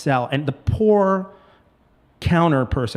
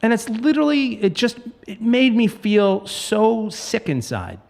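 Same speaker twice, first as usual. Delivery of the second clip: heated rant; 7 semitones higher than usual. Real, not acted. Ranting about realtors.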